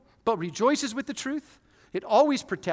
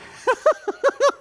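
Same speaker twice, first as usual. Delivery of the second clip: high loud laugh; 9 dB louder than usual. Real, not acted. Pastor laughing at his own confession, loud and high.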